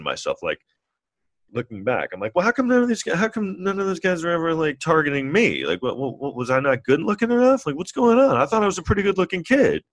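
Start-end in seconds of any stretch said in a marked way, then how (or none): 2.3-9.8 much higher, indignant whine; mock-insulted self-talk; offended kid self. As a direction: feel snubbed and insecure. Younger self feeling slighted.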